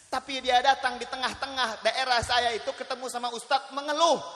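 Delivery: loud, high-pitched preacher's delivery; full-force preaching. Real, not acted. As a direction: drive it out loudly to the whole congregation.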